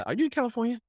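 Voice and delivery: ordinary delivery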